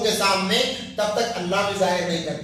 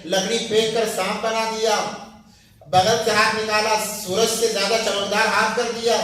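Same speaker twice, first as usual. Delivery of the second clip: ordinary talk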